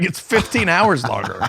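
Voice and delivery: high, emphatic retort; mock exasperation, pitched right up; exasperated dad. Dad answering his son three hours into the flight, exasperated.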